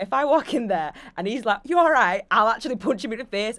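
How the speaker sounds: put-on loud, rough go at a Scottish accent; attempted Scottish accent. Retelling a housemate's threat in a loud, attempted Scottish accent. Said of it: "but James is Scottish".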